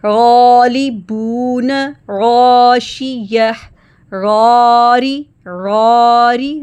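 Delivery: غاری slow, alif heavy and held. Shown as a demonstration. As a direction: say غالبون with a thick, long alif. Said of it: "heavy پڑھ رہے ہیں". (غین کے بعد موٹا الف)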